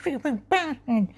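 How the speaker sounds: very high, indistinct indignant voice; cleft palate impression. Lady answering someone asking about her palate, high cleft-palate impression. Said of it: "And she'd be like".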